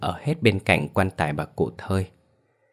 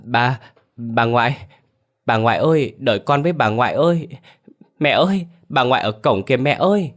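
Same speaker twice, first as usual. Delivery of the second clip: put-on high, tearful little boy calling for grandma; acted childish wail, pitched up; whimpering child; 5 semitones higher than usual. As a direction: call out plaintively like an upset small child. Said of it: "chỉ tay ra bên ngoài mà mếu máo khóc".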